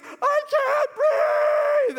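very high, drawn-out mock wail; hammed-up shrieking cry, slow and loud; crying yell. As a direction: imitate a winded kid yelling through tears. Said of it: "You're yelling at me".

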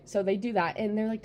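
ordinary talk, nothing marked

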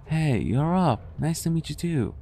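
high, lively friendly hello; upbeat greeting. Acted. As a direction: greet them with high, cheery energy. Cheerfully returning her greeting.

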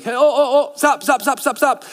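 high, urgent repeated stop; calling out a warning. Acted. The Spirit calling a believer to stop, high urgent calls. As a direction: shout after someone heading wrong way. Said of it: "he may be calling you like".

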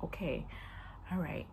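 soft, low soothing okay; calm reassurance. Retold or imitated. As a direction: settle her down gently. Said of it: "She's like".

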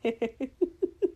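eerie rising giggle; unsettling little laugh; breathy laugh. Acted. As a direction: laugh in short breathy bursts, climbing at the end. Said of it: "and then he laughed".

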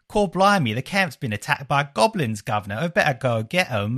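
cheeky London Cockney, hammed up; mock Cockney. Host imitating the game's goblin-attack line in a hammed-up Cockney. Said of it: "Whereas in this, it might be just like".

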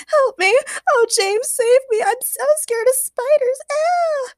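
high, loud squealing plea for help; panicked damsel shrieking over spiders; frightened scream. A narrator's reading. Agnes begs James to save her from spiders, screaming. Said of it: "Agnes Gertrude screamed again".

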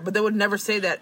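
usual speaking voice

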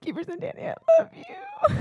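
choked-up gratitude to absent friends; tearful thanks. Real, not acted.

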